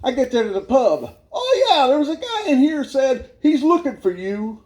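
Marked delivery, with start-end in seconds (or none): 1.3-4.6 lightly voiced pub local, pitched up. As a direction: start high on a helpful "oh, yeah".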